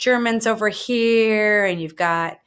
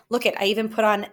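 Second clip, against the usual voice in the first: usual speaking voice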